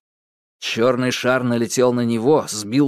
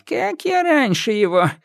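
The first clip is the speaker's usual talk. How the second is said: witch voice; 10 semitones higher than usual. A narrator's reading. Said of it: "вздохнула Яга".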